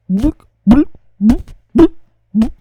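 loud rising boom imitation; booming sound effect. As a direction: pound out each boom. (гучне бухання)

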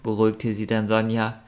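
ordinary delivery, nothing marked

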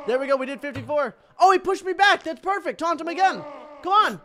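very high, loud elation; excited shouting. Real, not acted. Gamer pushing the opponent back, excited shouting. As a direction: yell with rising thrill. Thrilled as attack works.

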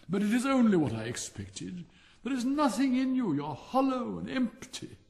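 high, loud, haughty scorn; a proud rocket looking down on everyone; scornful rocket. Acted. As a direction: sneer down at the empty balloon. Rocket scorns the fire balloon.

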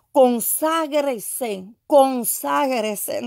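very slow, stretched exhortation; prophetic command. Retold or imitated. Preacher voices the Spirit calling for consecration, slow drawn-out command. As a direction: draw out each syllable solemnly.

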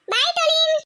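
high, drawn-out goodbye call. Acted. Child sees schoolfriend off, calling out goodbye. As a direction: call after a departing friend.

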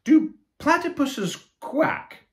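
very high, slow puzzled voice; knight asking. Acted. Knight in scaly armor questioning the platypus, high puzzled. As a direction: ask it wide-eyed. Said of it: "asked the knight".

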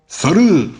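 high, loud football shout; shouted cheer. A narrator's reading. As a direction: yell it like a stadium chant. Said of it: "diye bağırdı Hamdi Bey". (yüksek sesle tezahürat bağırışı)

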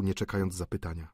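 ordinary delivery, nothing marked

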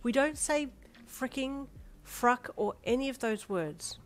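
slow, firm correction; chiding. A narrator's reading. Mother teaching child about swearing, chiding. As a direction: spell out the forbidden words slowly. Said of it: "you chided".

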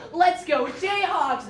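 high, loud mock mom cheer; imitated screaming cheer. Player imitating the coach's mom, mock scream. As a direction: mimic an overexcited mother screaming from the stands. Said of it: "start screaming".

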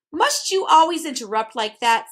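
irritable. A narrator's reading. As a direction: object with irritation. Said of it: "said Tok irritably".

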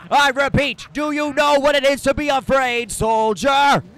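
high, loud barking officer; booming drill sergeant. Acted. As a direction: shout it down at a recruit. Major repeats his question.

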